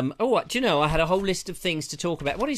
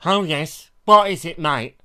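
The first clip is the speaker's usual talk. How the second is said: off-duty prince; 8 dB louder than usual. Retold or imitated. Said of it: "he went".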